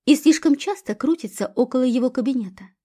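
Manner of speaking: ordinary delivery, nothing marked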